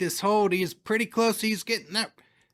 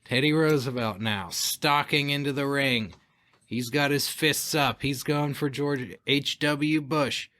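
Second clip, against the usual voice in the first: wrestling commentator; 3 semitones lower, slower than usual. Acted. Play-by-play of the mock match.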